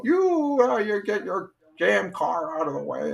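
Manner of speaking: strained-high New York City holler, mimicked; yelling New York accent. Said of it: "He'd yell out".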